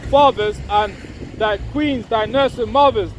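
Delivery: measured, elevated reading of scripture. Acted. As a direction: proclaim the verse slowly like a preacher. Reads Isaiah aloud.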